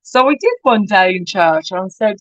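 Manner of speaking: ordinary delivery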